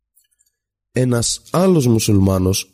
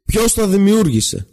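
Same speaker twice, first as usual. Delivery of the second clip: slow, higher, pushed-out question; sudden shout; 9 semitones higher, slower than usual. A narrator's reading. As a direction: hurl the question at the student. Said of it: "ξαφνικά φωνάζει στο φοιτητή". (ξαφνική φωνή, αργά και δυνατά)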